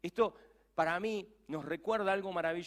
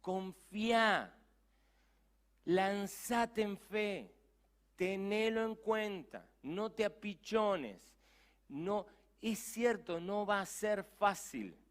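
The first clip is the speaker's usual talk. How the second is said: slow, drawn-out encouragement; slow pleading; 6 semitones higher, slower than usual. Real, not acted. Preacher urging the doubter slowly to trust. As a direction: lean in and plead each word slowly. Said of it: "slow pleading exhortation".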